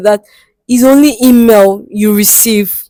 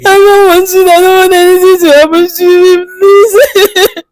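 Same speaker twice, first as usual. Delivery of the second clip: hammed-up sobbing plea, pitched much higher; crying; 8 semitones higher, 3 dB louder than usual. Caller begging the host not to let her die, in a hammed-up sob. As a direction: beg through fake tears. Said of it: "Stop crying".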